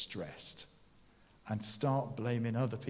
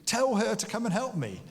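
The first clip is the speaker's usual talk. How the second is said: bossy demand; 8 semitones higher, 4 dB louder than usual. Retold or imitated. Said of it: "starts telling Jesus what to do".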